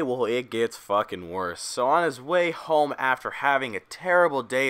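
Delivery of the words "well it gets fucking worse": ordinary talk, nothing marked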